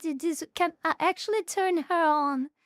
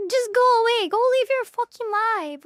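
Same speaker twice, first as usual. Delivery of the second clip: louder and higher, fed up, telling him to go away; exasperated yell; 5 semitones higher, 5 dB louder than usual. Real, not acted. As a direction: shout it at the screen like he can hear you. Yelling at man on screen.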